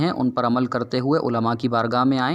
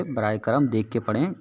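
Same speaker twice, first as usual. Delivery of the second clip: ordinary talk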